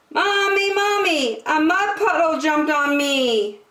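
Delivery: put-on high, upset little-girl cry for mommy; a muddy little girl wailing for her mother; child yelling for mommy. A narrator's reading. Muddy little girl calls her mommy, high and upset. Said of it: "ran inside yelling".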